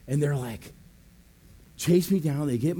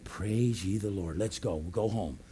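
hushed praise; 9 semitones lower, 10 dB quieter than usual. Retold or imitated. Stone cleaned, awed satisfaction.

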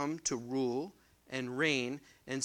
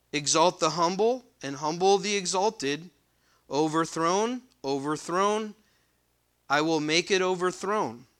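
raised, louder reading voice from the pulpit; scripture reading; 3 semitones higher, 6 dB louder than usual. Acted. Preacher reading Ezekiel aloud to the congregation, proclaiming. Reads the verses again.

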